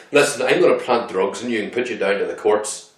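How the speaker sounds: loud, full-force PSNI officer; PSNI officer impression. A PSNI officer threatening a suspect, loud impression. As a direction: belt it out as a threatening policeman. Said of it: "most accurate P S N I portrayal".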